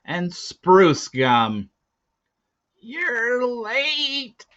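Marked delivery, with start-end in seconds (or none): 2.8-4.5 put-on high, thin old woman greeting. A narrator's reading. Kala greeting the late boy, high old-woman voice. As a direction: pitch it up like a kindly old woman.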